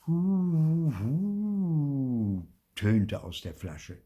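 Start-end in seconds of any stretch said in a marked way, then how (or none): 0.1-2.5 high drawn-out "hu-huu"; timid little ghost wail; anxious ghostly hoot. A narrator's reading. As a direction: let a frightened hoot slowly trail away. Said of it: "Ein ängstliches, leises Hu".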